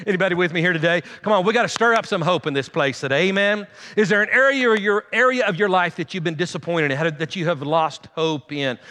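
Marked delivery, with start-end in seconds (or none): none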